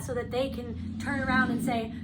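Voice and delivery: usual speaking voice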